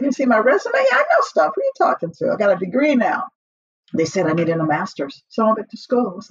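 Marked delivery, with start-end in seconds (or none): 0.0-0.8 higher, louder, incredulous; mock-outraged repeat of the question; indignant retort. To the college staff, indignant and higher. 0.8-3.2 much higher, offended brag; playing her past self's outrage; indignant boast. To the college staff, offended and much higher.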